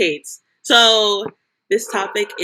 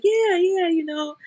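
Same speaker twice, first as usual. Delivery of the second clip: mock new girlfriend; 11 semitones higher than usual. Voicing new girlfriend in TikTok.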